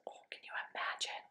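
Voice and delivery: a quick breathless whisper to the listeners; hushed whisper. Real, not acted. Storyteller asking the kids in a fast whisper. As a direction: whisper it fast, wide-eyed.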